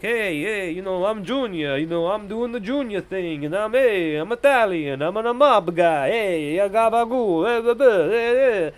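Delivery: a hint of a swaggering Italian mobster strutting down the steps; mob guy impression. Reviewer mocking Junior's swagger in a light Italian mob accent. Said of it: "being like".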